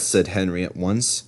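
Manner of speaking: usual speaking voice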